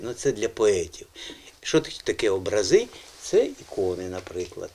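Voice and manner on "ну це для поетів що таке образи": normal talking voice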